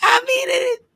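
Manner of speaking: high, breathy-sounding mock sweet voice; cute sexy voice. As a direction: tease her with a squeaky cute voice. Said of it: "stop that little cute, sexy voice".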